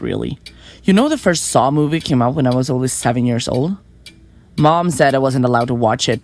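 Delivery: ordinary delivery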